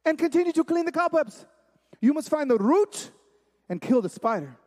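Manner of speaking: high, insistent imitation of dad; admonishing father. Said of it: "He says".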